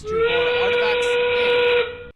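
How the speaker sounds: loud, high, excited play call; excited shout. Real, not acted. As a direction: shout the score with delight. Commentator calls a scoring shot.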